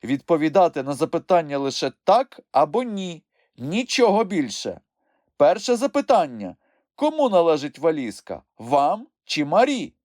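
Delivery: high, firm courtroom voice; judge questioning witnesses. A narrator's reading. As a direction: lay down the rules from the bench.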